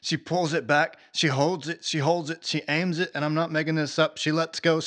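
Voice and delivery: normal talking voice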